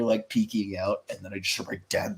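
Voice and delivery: usual speaking voice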